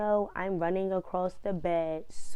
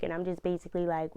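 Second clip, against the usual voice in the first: ordinary delivery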